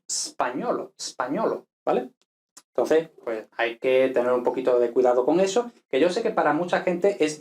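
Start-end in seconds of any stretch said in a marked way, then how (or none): 0.1-1.7 españolo as spagnolo, starting on a hissed s; liquid S. Shown as a demonstration. Teacher models Italian, españolo without initial E. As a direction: drop the E from españolo, straight into sp. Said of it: "decimos españolo".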